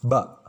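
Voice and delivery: "Ba" sounded cleanly between the lips; teacher demonstrating Arabic ba as "Ba"; clear letter sound. Shown as a demonstration. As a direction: model "Ba" with both lips closed then released.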